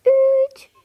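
high, loud cheer on the count; countdown cheer. Acted. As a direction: shout the new year in. Ikinci yılbaşı geri sayımı. (yılbaşı sevinç çığlığı)

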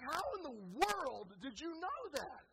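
high, loud, swooping disbelief; acted-out flabbergasted husband; incredulous. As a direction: demand it in exaggerated amazement. Baffled husband quizzes his wife.

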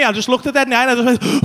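normal talking voice

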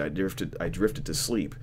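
usual speaking voice

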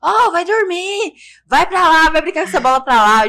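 loud, high yell out the window; yelling at boys playing ball at dawn; retold yell. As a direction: shout at the kids to go play elsewhere. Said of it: "Menina começa a gritar".

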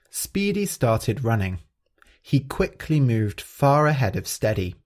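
ordinary delivery

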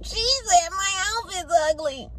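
high, mocking sing-song voice; high mocking retelling of the insult; annoying mocking voice. Shown as a demonstration. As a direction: say it like it's foolish to you. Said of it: "You can't say it like that".

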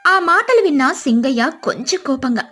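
usual speaking voice

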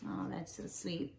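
soft whisper to herself; a real, hushed whisper of delight; whispered aside. Real, not acted. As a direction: breathe it out in a whisper. Aside after finishing the story.

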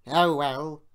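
loud, sulky sigh of giving in; put-upon sulk. A narrator's reading. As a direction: give in with bad grace. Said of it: "said Sir Handel sulkily".

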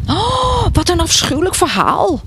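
high, shocked outcry; horrified exclamation. A narrator's reading. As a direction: recoil at the gossip with a high-pitched "oh". Listening neighbour is horrified by tale. (hoog en ontzet uitgeroepen)

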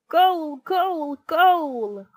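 loud, high-pitched cheers of goal; roaring crowd. A narrator's reading. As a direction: roar it like a football crowd. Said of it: "roared the crowd".

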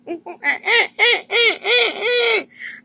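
high-pitched bursts of panicked wailing; frantic high sobbing laugh; hysterical wailing. Acted. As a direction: hysterical staccato cries, rising far above normal voice. Meltdown right after freaking out.

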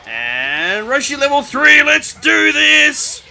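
drawn-out shout building to a high whoop; triumphant hype shout. Real, not acted. Player powers Roshi up to level three, triumphant shout. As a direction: yell it slowly, soaring into a whoop.

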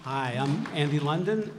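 ordinary talk

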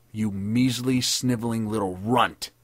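slow, emphatic angry insult; sudden swearing. A narrator's reading. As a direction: spit out the insult with contempt. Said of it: "he suddenly swore".

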